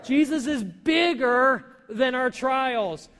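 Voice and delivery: preacher leading the congregation's answer, slow and loud; with conviction. Shown as a demonstration. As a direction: proclaim it like a creed. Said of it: "Let's say that with conviction".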